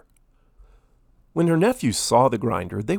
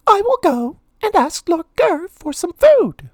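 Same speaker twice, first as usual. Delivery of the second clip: high, louder squeaky mouse voice; Minnie voice; 6 dB louder than usual. A narrator's reading. Poor maid deciding to ask for food, high mouse voice. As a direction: voice Minnie bright and determined.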